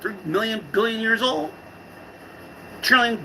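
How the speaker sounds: ordinary talk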